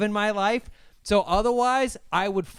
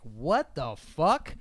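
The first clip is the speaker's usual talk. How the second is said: slow outrage; 3 semitones lower, slower than usual. Retold or imitated. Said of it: "you're like".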